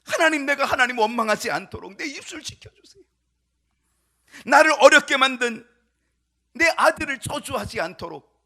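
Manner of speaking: loud, high crying-out prayer; fervent prayer. Acted. David praying not to curse his son or advisers, fervent. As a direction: beseech God with full force. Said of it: "그 기도하고 있어요".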